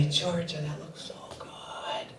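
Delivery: pleading whisper; hushed begging. Retold or imitated. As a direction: beg softly so no one hears. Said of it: "and I say".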